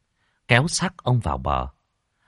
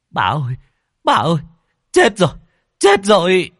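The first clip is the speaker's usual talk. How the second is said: high, loud frantic cry; breathless servant shouting alarm; panicked servant; 9 semitones higher, 7 dB louder than usual. A narrator's reading. Servant boy cries to the mistress in panic.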